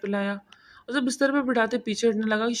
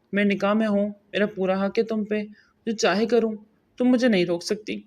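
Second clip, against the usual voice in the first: plain reading voice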